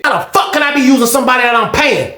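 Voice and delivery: high incredulous comeback, imitated; indignant retort. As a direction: repeat it with mock outrage. Repeats Walter's indignant comeback.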